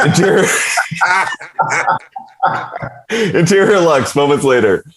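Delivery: high, laughing through the scene heading; laughing narration. Real, not acted. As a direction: crack up while reading the heading. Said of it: "that was my narrating".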